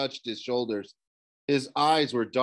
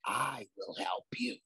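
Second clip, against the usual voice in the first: ghostly promise; 3 semitones higher, 9 dB quieter than usual. A narrator's reading. Ghost promises Nico help, soft and ghostly. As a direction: soothe him like a sly shade. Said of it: "the ghost promised".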